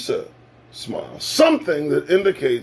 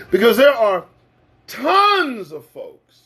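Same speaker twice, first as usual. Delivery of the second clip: ordinary talk, nothing marked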